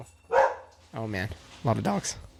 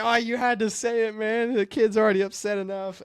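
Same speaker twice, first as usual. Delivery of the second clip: much higher hammed-up scolding crew voice; teasing crew guy; 10 semitones higher than usual. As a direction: play a crew member chiding his buddy. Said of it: "And he's like".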